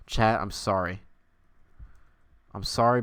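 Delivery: ordinary talk, nothing marked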